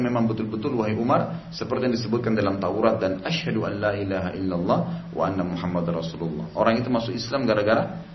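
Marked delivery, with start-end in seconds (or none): none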